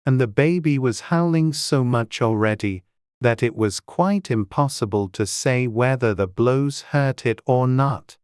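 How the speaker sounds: plain reading voice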